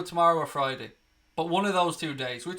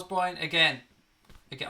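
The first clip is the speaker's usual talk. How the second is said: ordinary talk, nothing marked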